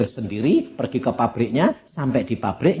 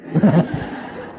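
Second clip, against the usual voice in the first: laugh. Real, not acted. Preacher laughs at own joke.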